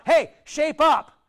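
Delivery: slow, high, loud stern rebuke; drawn out like a scolding parent; mock stern scolding. Preacher mimics a harsh God telling people to shape up, slow stern scolding. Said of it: "This is not about".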